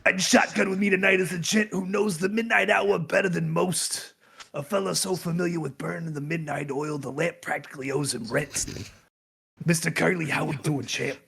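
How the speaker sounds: lightly voiced noir host; a hint of hard-boiled announcer; noir detective. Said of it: "a detective noir voice".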